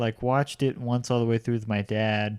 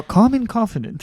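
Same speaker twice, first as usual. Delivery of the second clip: calm, assured dog trainer voice; Cesar Millan impression; 10 semitones higher, 5 dB louder than usual. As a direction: imitate a calm, confident trainer. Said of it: "I just hear his voice in my head".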